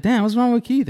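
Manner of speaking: mock high squeaky concern; high-pitched imitation. Host teasing Keith about his silence, squeaky high voice. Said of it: "He does the high pitch".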